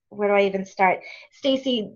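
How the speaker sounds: ordinary delivery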